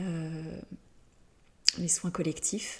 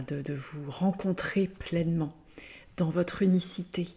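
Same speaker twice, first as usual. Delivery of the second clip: ordinary talk, nothing marked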